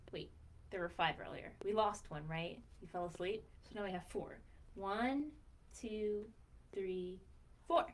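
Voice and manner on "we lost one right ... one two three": ordinary delivery, nothing marked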